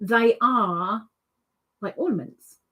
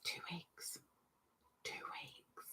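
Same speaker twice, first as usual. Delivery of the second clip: playful whisper; 5 semitones lower, 28 dB quieter, slower than usual. Pretend. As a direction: whisper Two weeks. breathily, almost silent. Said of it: "repeats whispered".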